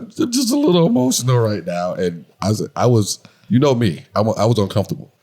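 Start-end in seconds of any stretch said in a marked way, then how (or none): 0.0-1.9 high, teary imitation of a friend; high mock teary Luke. Telling his wife how his friend Luke cried, high mock teary voice. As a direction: squeeze it up high like a choked-up buddy. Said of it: "He's like".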